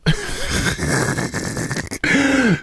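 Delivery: a long, high real laugh that trails off. Real, not acted. Podcast host laughing at his own procrastination joke, high laugh.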